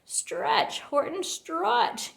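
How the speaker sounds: high half-voiced call, slow on Horton; a first, lighter command; mild yell. A narrator's reading. Quuggerbug urging Horton across the gap, a mild high call. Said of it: "yelled the bug".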